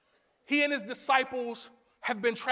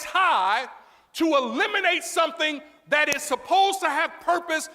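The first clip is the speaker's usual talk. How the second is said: loud, raised, emphatic sermon delivery; full-force preaching; 5 semitones higher, 6 dB louder than usual. Acted. Preacher declaring the useless thing must go, full-force preaching. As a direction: hammer each key word to the congregation. Preacher drives the point home.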